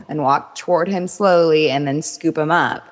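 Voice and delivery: ordinary talk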